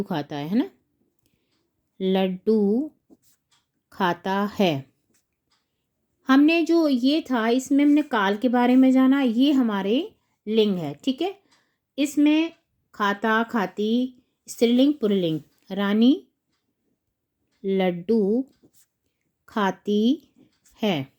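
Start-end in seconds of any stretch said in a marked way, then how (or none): 2.0-4.9 Laddu drawn out rising, pause, slow खाता है; word by word, falling on है; slow dictation. Shown as a demonstration. Teacher writing out the king's answer, slow dictation. 15.7-21.1 one word at a time, long pauses; slow dictation. Shown as a demonstration. Teacher writing the queen's sentence, slow dictation.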